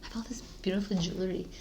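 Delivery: hushed early-morning wonder. Retold or imitated. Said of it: "and I was like".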